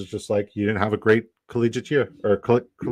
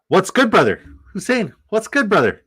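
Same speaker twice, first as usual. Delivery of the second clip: excited greeting; 11 semitones higher, 5 dB louder than usual. Real, not acted. Greeting a viewer in chat.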